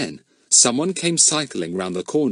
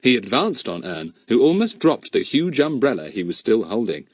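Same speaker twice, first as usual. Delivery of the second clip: ordinary delivery, nothing marked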